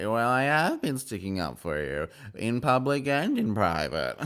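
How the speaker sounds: swooping, exaggerated castmate impression; hammed-up imitation of a reality star; Meredith. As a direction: lift and stretch the words in a put-on voice. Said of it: "I love doing the Meredith voice".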